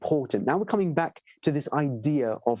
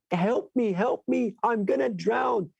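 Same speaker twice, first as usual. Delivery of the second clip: higher, pleading cry of a drowning man; a drowning man begs the companions, desperate and higher; desperate cry for help; 7 semitones higher than usual. A narrator's reading. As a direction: beg for rescue as the water takes you. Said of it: "out of desperation".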